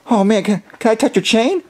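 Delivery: ordinary talk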